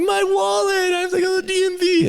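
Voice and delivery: high whiny fast wail; comic adult crying over a lost wallet, high fast whine; mock crying. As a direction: play a mock-sobbing adult. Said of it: "they'd be crying".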